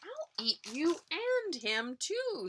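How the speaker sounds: high, slow greedy troll; troll threatening both goats. Acted. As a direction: stretch the too upward hungrily. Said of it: "says Troll".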